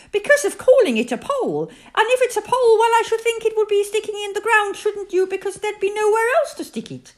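high, loud, know-it-all character voice; blustering Rabbit. A narrator's reading. Rabbit explains the pole to Christopher Robin, high and blustering. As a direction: rattle on high, sure of yourself. Rabbit covers up not knowing.

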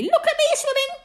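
very high squeaky boast; bubbling proudly. A narrator's reading. Roo shows off while drifting, bubbling proudly. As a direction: squeak it out full of pride. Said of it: "still bubbling proudly".

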